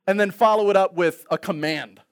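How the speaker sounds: ordinary talk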